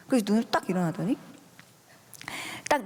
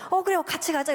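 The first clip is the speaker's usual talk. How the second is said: delighted high agreement; 8 semitones higher than usual. Retold or imitated. Mother to her daughter heading to brush teeth, delighted. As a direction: jump up in pitch, pleasantly surprised.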